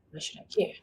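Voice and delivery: breathy, low inner voice; whispered thought. Retold or imitated. A part of her mutters, whispered and low. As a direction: say it under your breath.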